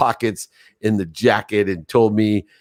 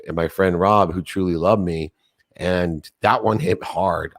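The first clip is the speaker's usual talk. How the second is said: ordinary talk, nothing marked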